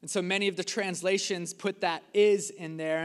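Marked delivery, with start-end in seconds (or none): 2.1-2.5 "is" punched out louder; emphatic IS. Shown as a demonstration. Preacher pointing out a word in the translations, emphatic "is". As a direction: hit "is" hard, set apart from the sentence. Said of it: "put an emphasis on that word".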